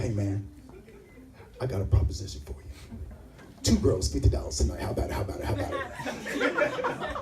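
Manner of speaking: low, hushed, secretive offer; a shady murmured hustle; hushed sales pitch. Retold or imitated. Stranger hustling comic at night, hushed and low.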